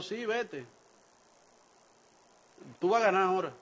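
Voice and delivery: soft, plain telling-off without irony; flat, sincere take. Shown as a demonstration. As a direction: say it straight and quiet, no mockery. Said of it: "esa no es la característica del lenguaje irónico". (versión sincera y en voz baja)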